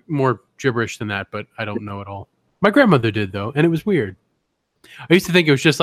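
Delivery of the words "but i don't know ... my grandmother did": normal talking voice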